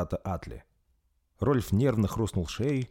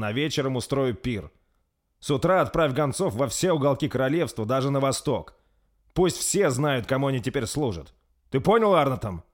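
louder commanding orders; imperious; 6 semitones higher, 4 dB louder than usual. A narrator's reading. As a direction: give orders like a ruler. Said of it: "властно произнёс Рольф". (Рольф властно отдаёт приказы капитану)

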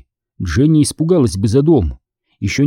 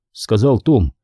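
ordinary delivery, nothing marked

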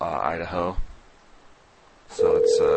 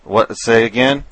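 raised voice over a bad line; loud on the phone; 3 semitones higher, 9 dB louder than usual. Real, not acted. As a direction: call out to be heard. Straining to hear caller.

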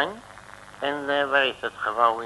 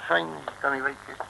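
ordinary talk

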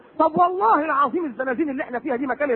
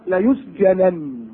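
slow drawn-out verse; Quranic recitation; 4 semitones lower, much slower than usual. Acted. Preacher recites the wife of al-Aziz's threat, slowly chanted.

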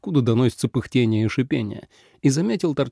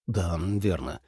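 low, soft agreement; subdued, lower-pitched assent; conceding; 6 semitones lower, 8 dB quieter, slower than usual. A narrator's reading. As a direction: concede the point quietly. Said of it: "кивнул Ли Цзэ".

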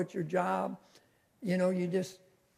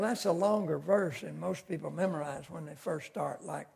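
ordinary talk, nothing marked

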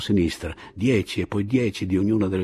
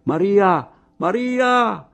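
much higher, much louder joyful call; calling from far below; 14 semitones higher, 8 dB louder, slower than usual. Acted. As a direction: yell it up the hill to her. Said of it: "udì la voce grossa, profonda di Pilar".

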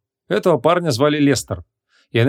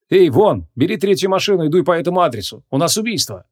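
cheerful call; 6 semitones higher than usual. A narrator's reading. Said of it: "весело окликнул её дежурный". (весело и громко окликает)

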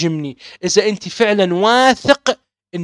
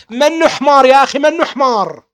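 loud, outraged cry; indignant shout; 4 semitones higher, 4 dB louder than usual. Real, not acted. Preacher rejecting insults against his grandfather, shouting indignantly. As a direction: throw the insult back in outrage.

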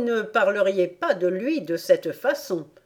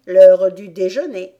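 plain reading voice

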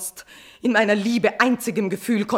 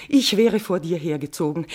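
ordinary talk, nothing marked

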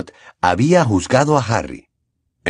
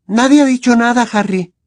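put-on higher girl's voice, urgent; Hermione exclaiming; 13 semitones higher, 4 dB louder than usual. A narrator's reading. Hermione urging Harry to leave, higher and louder. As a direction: call him away, a little louder. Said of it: "exclamó Hermione".

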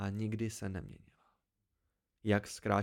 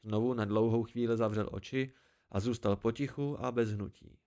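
ordinary delivery, nothing marked

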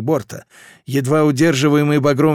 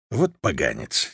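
much lower, slow scornful growl; disapproving grumble; 11 semitones lower, 6 dB quieter than usual. Acted. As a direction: growl it with contempt.

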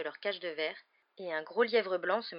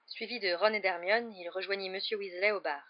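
ordinary delivery

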